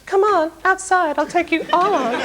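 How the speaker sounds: high, thin mock tough-guy challenge. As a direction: pick a fight in a tiny high falsetto. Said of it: "when [name] snaps".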